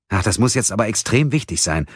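gereizt und etwas lauter; fauchend. A narrator's reading. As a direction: genervt ins Telefon fauchen. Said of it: "fauchte sie". (Mia faucht genervt den Anrufer an)

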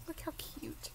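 a soft aside gushing over a cute wand; whispered cooing. Real, not acted. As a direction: whisper it like you're cooing over something tiny.

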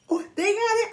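high, thrilled discovery; excited. Retold or imitated. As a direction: squeal it like finding treasure. I was like" in store.

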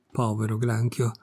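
ordinary delivery, nothing marked

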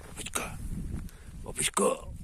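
soft, breathy pet name; tender whisper. Real, not acted.

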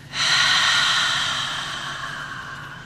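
long, audible open-mouth sigh; slow releasing breath out; long exhale. Shown as a demonstration. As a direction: breathe all the way out through the mouth. Demonstrates exhale through the mouth.

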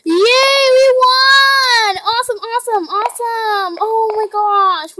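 high shrieking victory cry; shrieking jackpot winner. Real, not acted. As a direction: squeal with joy at winning. Wins the round.

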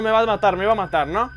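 normal talking voice

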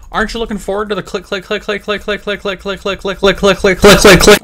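mock the viewer, building to a yell; teasing build-up. Taunting viewers with click noise.

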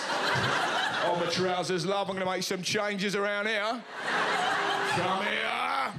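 husky, lecherous mock-flirt; self-service checkout propositioning a shopper; leering checkout machine. Hammed-up lecherous come-on.

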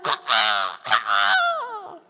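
squawking crow caws; strained bird cawing as the heat hits. Acted. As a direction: caw like a startled crow. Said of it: "he's like".

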